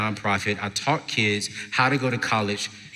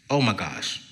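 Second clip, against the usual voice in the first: ordinary talk, nothing marked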